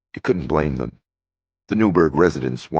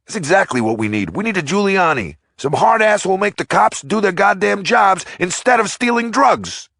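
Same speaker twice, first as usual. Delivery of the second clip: much higher and louder ranting tough guy; angry mustached guy; 6 dB louder than usual. A narrator's reading. Mustached man venting to the crowd, loud angry rant. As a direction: rage at the cops in a rough voice.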